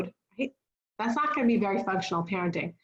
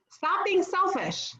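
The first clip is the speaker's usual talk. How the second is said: higher, drawn-out parental reprimand; mock scolding; 9 semitones higher, slower than usual. Parent tells off a selfish child at the candy table, mildly scolding. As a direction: scold a child, lightly acted.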